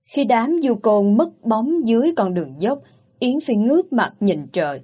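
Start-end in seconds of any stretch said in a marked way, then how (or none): none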